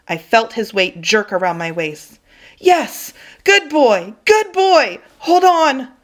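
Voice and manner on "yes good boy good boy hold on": high, loud relieved cheer; overjoyed cheering. Acted. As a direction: burst out as he bites on. Dog grabs the rope.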